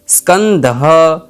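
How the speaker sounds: each word drawn out slowly for pupils to repeat; a teacher says each Sanskrit word very slowly for repetition; slow careful pronunciation. Shown as a demonstration. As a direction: slowly spell out every cluster so the class can follow. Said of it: "इनका उच्चारण मेरे साथ करना है".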